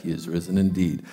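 low, soft lifeless "He is risen indeed."; zombie monotone. Retold or imitated. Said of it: "like we're a bunch of zombies".

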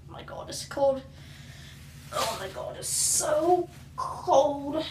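breathy gasping from the cold; pained whisper. Real, not acted. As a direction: squeeze words out through the cold. Holding ice.

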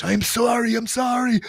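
high, begging I'm sorry from a floored thug; mock the bully's whimpering apology; knocked-down bully begging for mercy in a high, pleading voice; pleading beaten bully. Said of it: "now he's speaking perfect English".

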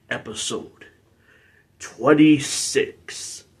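slow, drawn-out number announcement; announced. Acted. Announces episode number, then echoes it.

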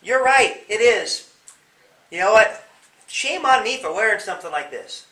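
loud, high, over-the-top cheerful yes; merrily agreeing with the bully's insult; cheerful agreement. Shown as a demonstration. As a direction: beam and happily agree to disarm the bully. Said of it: "You could say".

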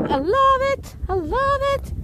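high, bright burst of joy; excited squeal. Real, not acted. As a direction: squeal it with delight. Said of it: "repeats with rising excitement".

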